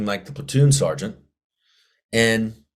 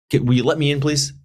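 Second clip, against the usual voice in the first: pleading; 7 semitones higher, 3 dB louder than usual. Retold or imitated. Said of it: "I was like".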